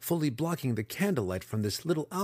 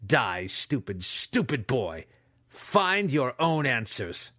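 contemptuous villain; 3 semitones higher, 4 dB louder, slower than usual. A narrator's reading. Villain's final curse.

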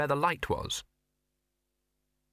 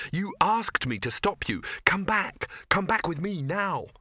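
higher, urgent pleading; urgent plea; 7 semitones higher than usual. Acted. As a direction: beg her to come back. Victor pleading with Ginger.

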